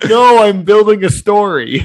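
loud, high-pitched objection; exasperated protest. Real, not acted. Storyteller defending himself to co-host, exasperated. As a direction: push back loudly over the teasing. Protesting the teasing about Kevin.

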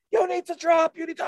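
high-pitched imitation of a warning; retold urgent call. Man beside runaway skier, urgent high call. Ski patrol calling out to skier.

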